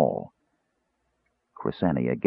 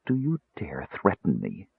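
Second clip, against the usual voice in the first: usual speaking voice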